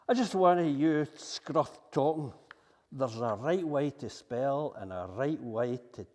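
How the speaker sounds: speaking the poet's voice back at the newsreaders; dialect poem recitation. Acted. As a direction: push the broad Scots lines defiantly. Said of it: "the six o'clock news by Tom Leonard".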